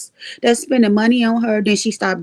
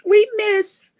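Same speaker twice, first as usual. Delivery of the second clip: higher, laughing and pleading; laughing plea; 8 semitones higher than usual. Real, not acted. Begging old friends to come back, laughing. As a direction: beg them back through laughter.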